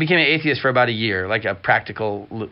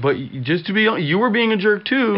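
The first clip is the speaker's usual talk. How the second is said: mock accusing; 9 semitones higher than usual. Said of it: "I don't try to be like".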